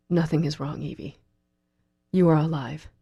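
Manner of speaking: hoarse tone. A narrator's reading. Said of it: "he replies in a hoarse tone".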